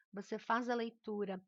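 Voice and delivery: usual speaking voice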